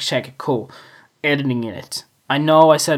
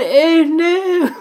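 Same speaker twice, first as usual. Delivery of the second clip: high playful hey no; catchphrase squeal; 12 semitones higher, 4 dB louder than usual. Acted. Repeating his catchphrase to fans, high squeal. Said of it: "I said".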